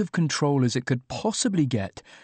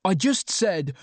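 raised-pitch narrated yell; high, moderately forceful yelling; exasperated yell; 7 semitones higher, slower than usual. A narrator's reading. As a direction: protest in exasperation, pitch pushed up. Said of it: "I yelled".

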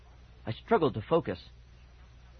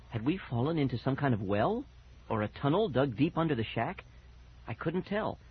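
ordinary delivery, nothing marked

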